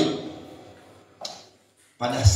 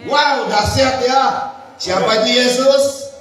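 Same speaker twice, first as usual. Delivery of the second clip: much higher and louder, calling out to the crowd; call and response preaching; 11 semitones higher, 5 dB louder than usual. Real, not acted. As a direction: rouse the congregation to answer. (berseru mengajak jemaat menjawab)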